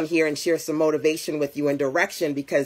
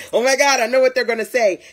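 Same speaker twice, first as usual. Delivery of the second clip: louder, quick, panicky self-talk; mock panic; 6 semitones higher, 6 dB louder, faster than usual. Telling viewers about dreading people, in hammed-up panic. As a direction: rush it like you're already dreading the reply.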